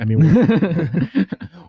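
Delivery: laughing through the words; can't stop laughing. Real, not acted. Laughs at sleep-with-me joke.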